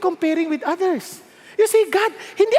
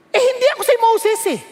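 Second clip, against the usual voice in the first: higher-pitched protest of inadequacy; protesting Joshua; 6 semitones higher, faster than usual. Acted. Joshua telling God he is no Moses, protesting. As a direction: plead that you are not up to it. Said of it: "He was saying".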